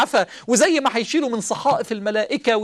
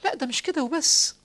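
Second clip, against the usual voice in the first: ordinary talk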